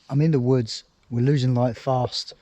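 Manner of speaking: ordinary talk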